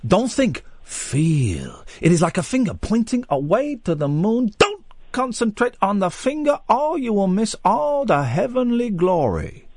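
lightly done Bruce Lee, slow and lofty at the end; a hint of a martial arts master's wisdom; mild kung fu sage voice; Bruce Lee impression. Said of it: "My br-, best Bruce Lee impression".

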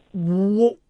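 ordinary talk

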